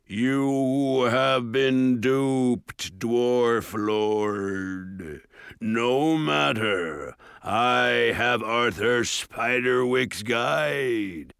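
loom over them, cold and slow; menacing ogre. A narrator's reading. Ogre gloats.

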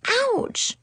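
high, small whimper of pain; high little "ouch". A narrator's reading. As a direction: whimper it under your breath. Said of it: "I whimpered under my breath".

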